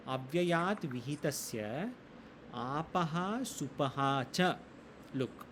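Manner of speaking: very slow, spaced reading of each word; slow word-by-word recitation. Shown as a demonstration. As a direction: separate every word for students. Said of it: "breaks the sutra into parts".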